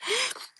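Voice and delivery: mock startled gasp; high little gasp of alarm; sharp gasp. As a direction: suck in a quick startled breath. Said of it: "I'm like".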